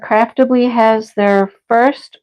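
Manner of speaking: ordinary talk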